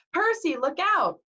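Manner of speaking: high, drawn-out warning cry; Annabeth crying out. A narrator's reading. Girl warning her friend of the lunge, high cry. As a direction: call out in urgent alarm. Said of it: "Annabeth cried".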